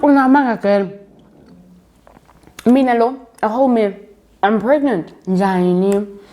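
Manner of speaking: high-pitched retold dismay; shocked disbelief. Patient reacts to learning she is pregnant, shocked.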